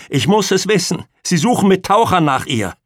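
high, loud frantic insistence; shrill voice. A narrator's reading. Pamela tells Martin divers are searching, shrill. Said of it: "fragte Pamela mit schriller Stimme".

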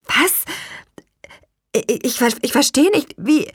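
high, halting disbelief; shocked stammer. A narrator's reading. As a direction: stumble over the words, stunned. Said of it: "stammelte ich".

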